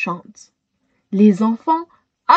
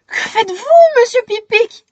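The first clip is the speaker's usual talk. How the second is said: breathed; 11 semitones higher, 3 dB louder than usual. A narrator's reading. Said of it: "souffle Shayna".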